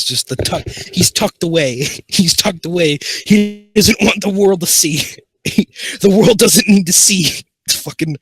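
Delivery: talking through real laughter, high and loud; helpless, high-pitched amusement; cracking up. Real, not acted. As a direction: barely get the words out for laughing. Laughing at the absurd thumbnail.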